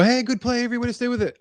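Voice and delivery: high, upbeat retold cheer; coach's praise. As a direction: call out encouragement to a young player. Coach praising player in retold play.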